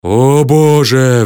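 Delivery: slow, loud wail of despair; howl. A narrator's reading. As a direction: stretch it into a loud, long howl. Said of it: "взвыл я". (протяжный громкий вой отчаяния)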